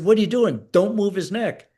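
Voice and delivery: high, loud warning yell at a bystander; retold alarmed shout. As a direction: stop him cold, half-yelling. Medic yelling at army guy.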